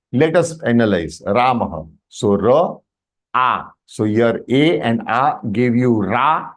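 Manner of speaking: ordinary delivery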